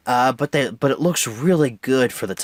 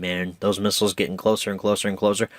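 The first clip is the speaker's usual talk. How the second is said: normal talking voice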